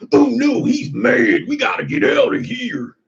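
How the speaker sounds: high, mock-panicked cowboy voice; imitating the skit voice in a fake panic. To the angry boss, in a high mock-panicked voice. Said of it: "I just kept doing the voice".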